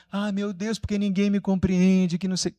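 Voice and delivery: high-pitched, put-on woe-is-me lament; mock victimhood. Preacher mimicking someone who feels misunderstood, mock victimhood. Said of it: "Isso é um vitimismo".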